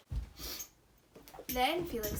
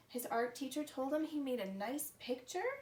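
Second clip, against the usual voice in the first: ordinary delivery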